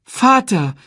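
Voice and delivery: high, loud desperate plea; begging. A narrator's reading. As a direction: cry out to stop him leaving. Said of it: "bettelte Simba". (hoch und flehend rufen)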